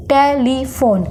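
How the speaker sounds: Telephone in three separate beats; split into three syllables. Shown as a demonstration. Teacher counting pauses, telephone broken in three. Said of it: "जितनी बार हम pause लेंगे".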